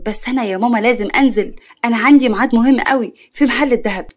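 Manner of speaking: ordinary delivery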